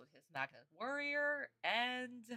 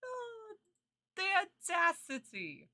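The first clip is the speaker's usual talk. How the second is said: mock outrage; 13 semitones higher, 4 dB louder than usual. Mock outrage after laughing at spoiler.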